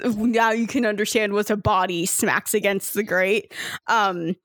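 normal talking voice

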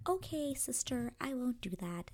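high, sing-out sister reply; placating sister. Retold or imitated. Younger sister answering Kate's warning, placating. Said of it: "she's kind of like".

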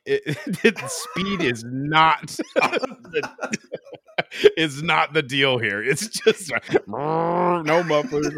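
high, loud, breaking up with laughter; laughing while talking. Real, not acted. Explaining a junker car race to a friend, laughing. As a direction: crack up mid-sentence, drawing words out.

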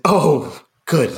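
a hint of a smiling, clueless uncle; Uncle Desmond. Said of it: "always there and always smiling".

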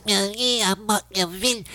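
mocking radio host voice, high and nasal; Mark Levin impression. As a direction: pinch your nose and sneer it out. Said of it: "he talks like this with a pinched up nose".

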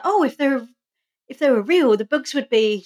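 mock-eager schoolboy; quoting Xander. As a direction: perk up as the eager boy. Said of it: "and he's like".

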